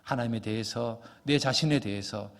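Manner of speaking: low-pitched, earnest congregational prayer; closing prayer. Real, not acted. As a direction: pray aloud on behalf of the congregation, pitched low.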